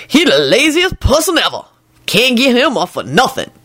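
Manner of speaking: high-pitched scornful complaint; scornful servant. A narrator's reading. Lucretia complaining about a lazy man, scornful. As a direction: scoff in a high character voice.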